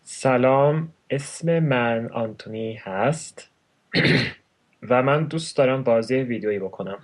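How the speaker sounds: measured, separated Persian words; slow it down for the listener. Shown as a demonstration. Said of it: "say it a little slower".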